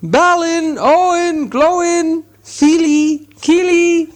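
very high, loud calls into the dark; desperate high shouts for the dwarves; crying out as loud as he could. Acted. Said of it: "he cried as loud as he could".

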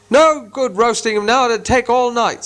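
a troll complaining about the wait. Acted. Gandalf mimicking a troll to the others, high gruff voice. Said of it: "said a voice".

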